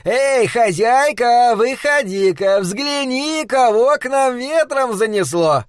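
high, slow call to the back room. Acted. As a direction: call the wife out to see. Flanagan calls to his wife.

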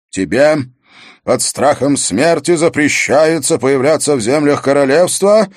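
loud, ceremonial royal decree; stern king passing sentence. Acted. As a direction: proclaim it to the hall, phrase by phrase. King continues the banishment decree.

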